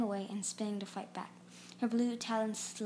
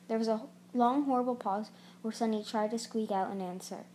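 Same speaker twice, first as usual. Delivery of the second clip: usual speaking voice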